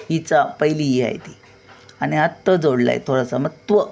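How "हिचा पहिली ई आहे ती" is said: ordinary delivery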